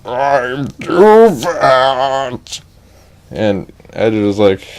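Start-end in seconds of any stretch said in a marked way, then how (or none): 0.1-2.6 very slow, higher drawn-out whine; fairy voice. Acted. Fat fairy explaining to Edge, very slow whine. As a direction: stretch each word out.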